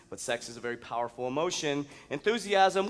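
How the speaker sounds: ordinary talk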